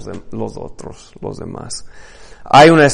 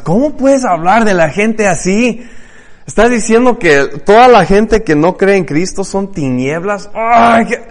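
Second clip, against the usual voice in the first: higher and louder, scandalized; indignant objector; 8 semitones higher, 6 dB louder than usual. Retold or imitated. Objector to preacher, higher and louder, scandalized. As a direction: play the offended listener, shocked.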